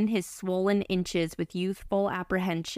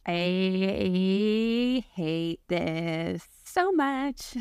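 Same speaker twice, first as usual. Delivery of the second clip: groaning disgust; much slower than usual. Real, not acted. Cohost groans at the story.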